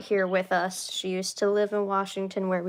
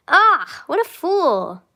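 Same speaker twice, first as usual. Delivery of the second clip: self-mocking; 8 semitones higher, 7 dB louder, slower than usual. Real, not acted. Mocking herself dramatically.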